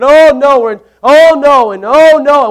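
loud, high "Oh, no" cries of mock alarm; mock panic. Preacher acts out panic when problems hit, loud mock alarm. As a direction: cry it out like sudden panic.

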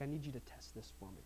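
a low hushed ask to a friend, keeping it secret; asking quietly so nobody else hears; hushed secret request. Retold or imitated. Man to his university friend, hushed secret request. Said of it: "he's like".

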